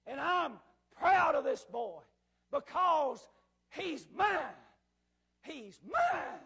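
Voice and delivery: loud, slow, emphatic claim; proud declaration. Acted. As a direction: declare it to the whole room with feeling. Man publicly claims hurt boy.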